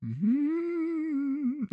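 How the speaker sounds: high, drawn-out moaning hum. Retold or imitated. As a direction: hum it like an old lady savouring food. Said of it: "she goes".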